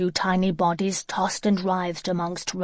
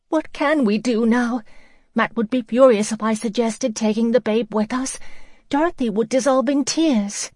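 higher, louder anxious voice; distraught; 5 semitones higher, 4 dB louder than usual. Acted. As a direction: near tears, fretting. Hannah distraught, near tears.

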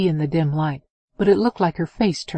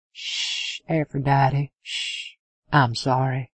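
calm her down gently; soothing hush; 3 semitones lower, slower than usual. A narrator's reading.

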